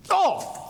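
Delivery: sharp high yelp of surprise; sudden pain yelp. Retold or imitated. Teacher acting out banging his shin, high yelp. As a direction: yelp as the shin hits the rack. Hitting shin on weight tree.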